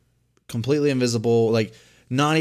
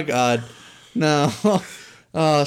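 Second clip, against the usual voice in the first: ordinary talk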